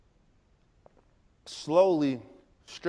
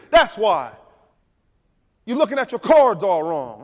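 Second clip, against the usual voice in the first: loud, high rousing sermon of a father; father roaring motivation at his son; preaching dad; 11 semitones higher, 12 dB louder than usual. Retold or imitated. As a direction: hype him up like a preacher. Dad's loud motivational speech.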